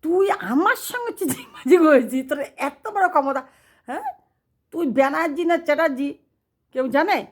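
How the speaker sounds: high, loud scolding; enraged god. Retold or imitated. Enraged god scolding the cheating Chatterjee. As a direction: thunder down at him like a furious god. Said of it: "ভগবান খেপে গেলেই তেমন বলে".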